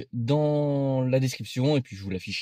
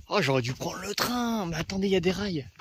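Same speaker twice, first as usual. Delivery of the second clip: whiny complaint; 6 semitones higher, 3 dB quieter than usual. Real, not acted. Regretting walking instead of train.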